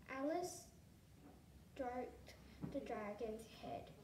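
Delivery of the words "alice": ordinary delivery, nothing marked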